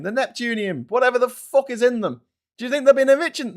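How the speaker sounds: loud, high-pitched shouted tirade; incredulous rant. Real, not acted. Streamer rants about Russia's neglected bombs, loud and incredulous. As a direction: shout it in exasperated disbelief.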